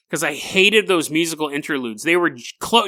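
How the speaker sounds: normal talking voice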